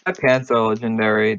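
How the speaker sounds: ordinary delivery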